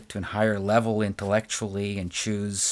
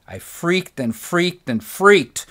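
louder and higher, slow, building panic; hammed-up panic, higher and louder; mock freak-out; 8 semitones higher, 8 dB louder than usual. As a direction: replay the freak-out with a drawn-out wail. Retelling his panic.

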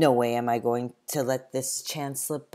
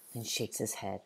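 ordinary delivery, nothing marked

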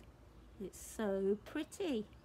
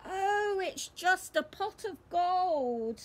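higher, a touch of let-down sigh; mock disappointment, lightly; sighing letdown; 7 semitones higher, 4 dB louder than usual. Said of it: "sighs Peppa".

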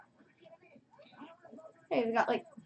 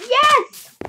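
loud, high shout of triumph; excited cheer; 11 semitones higher, 9 dB louder than usual. Real, not acted. Player sees rivals lose emerald point, excited cheer.

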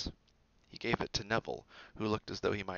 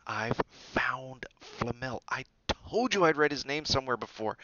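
excited; 4 semitones higher, 3 dB louder than usual. Acted. Harry's discovery.

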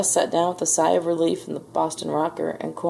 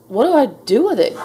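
louder and higher, swooping exclamation; dismayed cry; 6 semitones higher, 6 dB louder than usual. A narrator's reading. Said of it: "Gus cried".

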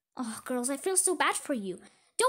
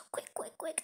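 hurried breathy whisper, voice off; urgent whisper; 10 dB quieter than usual. Acted. Sister hurrying her sister before Daddy comes, whispered. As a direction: whisper fast so Daddy can't hear.

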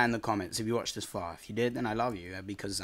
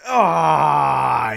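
loud raging cry; pained yell; angry shout; 3 semitones higher, 13 dB louder than usual. Real, not acted. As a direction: roar of frustration. Raging at killer.

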